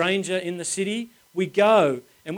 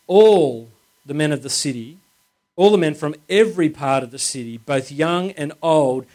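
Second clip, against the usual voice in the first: louder, stressing ALL in "all the men"; emphatic reading; 2 semitones lower, 5 dB louder than usual. Shown as a demonstration. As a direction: lean on "all" as you read the verse. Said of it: "Can you see the emphasis?".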